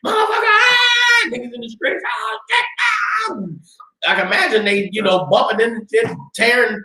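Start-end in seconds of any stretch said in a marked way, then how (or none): 0.0-1.3 high, terrified shrieks torn from a nightmare; panicked screaming. Acted. Sons running out screaming. 2.1-3.4 high, terrified shrieks torn from a nightmare; panicked screaming. Acted. People screaming in streets.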